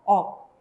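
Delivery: slow "o" with an unreleased k at the end; Korean syllable ok. Shown as a demonstration. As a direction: read the syllable "o" deliberately. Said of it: "¿cómo se va a leer esta sílaba?".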